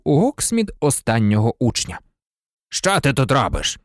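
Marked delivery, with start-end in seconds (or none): none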